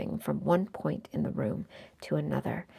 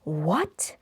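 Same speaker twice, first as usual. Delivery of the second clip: shouted; 5 dB louder than usual. A narrator's reading. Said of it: "shouted the pilot captain".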